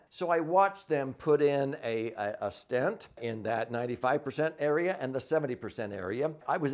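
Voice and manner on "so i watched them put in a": normal talking voice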